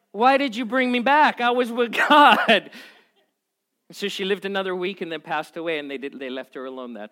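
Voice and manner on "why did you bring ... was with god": imitating an indignant woman, loud and high; mock-outraged complaint with a laugh in it; ticked off. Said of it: "She was ticked at them".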